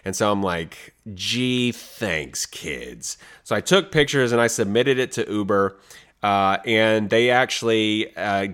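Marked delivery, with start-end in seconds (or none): 1.1-3.2 dry sarcasm. Retold or imitated. Sarcastic about mud in car.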